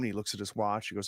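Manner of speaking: ordinary talk, nothing marked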